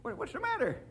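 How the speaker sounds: put-on high, quiet old codger voice; deflated, high-pitched questioning; puzzled old-timer.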